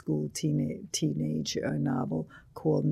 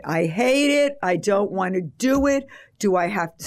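loud, higher-pitched balking protest; exasperated, raised-voice refusal; whining protest; 4 semitones higher, 5 dB louder than usual. Retold or imitated. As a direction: protest loudly like a reluctant kid. Acted protest to her editor.

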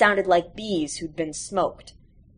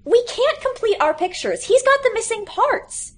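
put-on much higher childish whine; whiny kids. A narrator's reading. Classmates whine to the librarian about missing parts. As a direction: pitch it up like a nagging kid.

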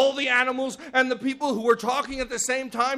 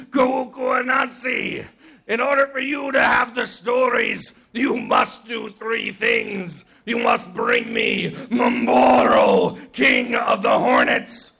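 slow, booming giant proclamation; rough, stretched-out godly command; imposing sky god; 4 dB louder, slower than usual. Acted. Sky god sets Anansi three tasks, booming and slow.

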